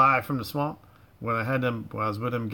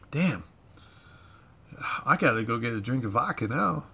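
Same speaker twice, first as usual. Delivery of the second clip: ordinary talk, nothing marked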